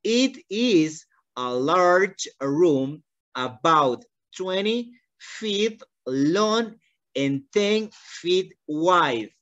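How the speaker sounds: extremely slow, every word drawn out; very slow model reading. Shown as a demonstration. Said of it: "Una vez más". (lectura muy lenta una vez más)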